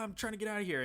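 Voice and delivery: high, pleading cry from a trapped man; desperate call for help. Retold or imitated. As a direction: call out like you're stuck in a trench. Trapped guy calling for help.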